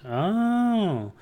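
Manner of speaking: high, drawn-out mock-wondering "ohhh"; exaggerated "oh". Streamer reacting to a viewer's snow remark, exaggerated "oh". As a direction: swoop up high and hold the "oh".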